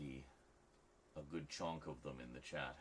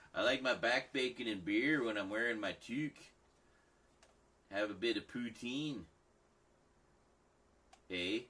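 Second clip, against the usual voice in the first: higher, louder mock Canadian, "eh" tacked on; 6 semitones higher, 6 dB louder than usual. Streamer riffs on Canadian clichés in a Canadian accent. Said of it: "stereotypical Canadian accent".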